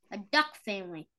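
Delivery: ordinary talk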